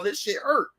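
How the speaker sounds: ordinary talk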